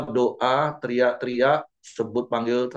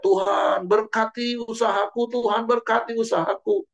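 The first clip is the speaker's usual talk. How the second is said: high, insistent pleading cry; hammed-up shouted prayer; mock crying out; 10 semitones higher than usual. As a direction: imitate a believer shouting up to God. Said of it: "kita teriak".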